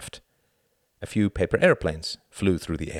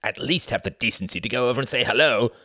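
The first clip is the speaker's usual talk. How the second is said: venomous, mildly raised reproach; venomous hiss; 5 semitones higher, faster than usual. A narrator's reading. Tom's relative reproaching someone, venomous. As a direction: scold with venom, pitch lifted a little. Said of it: "he hissed venomously".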